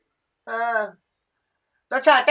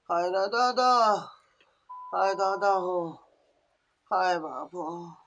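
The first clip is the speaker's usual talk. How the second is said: low pained moan; 8 semitones lower, 10 dB quieter than usual. Acted. Old woman groaning in pain.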